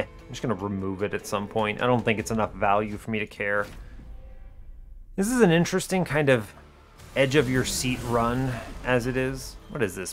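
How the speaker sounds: normal talking voice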